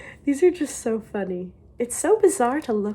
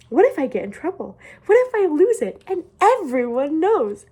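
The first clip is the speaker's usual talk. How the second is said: higher, louder mock panic; mock worried diary reading; 7 semitones higher, 6 dB louder than usual. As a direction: read it like a panicked kid. Worried diary entry read aloud.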